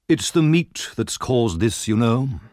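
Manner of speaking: slow, lightly pompous pronouncement; a hint of pompous official; pompous beadle. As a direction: explain it with self-important certainty. Pompous beadle explains to woman.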